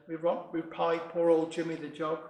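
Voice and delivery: ordinary delivery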